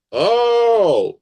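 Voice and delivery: a high, loud, startled "oh"; light up as it suddenly clicks; sudden realisation. Retold or imitated. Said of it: "it reboots it of".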